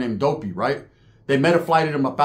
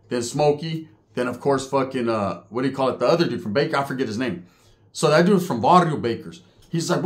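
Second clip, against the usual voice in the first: ordinary talk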